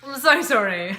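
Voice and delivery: giggling through a sorry; laughing apology. Real, not acted. Laughing after swallowing.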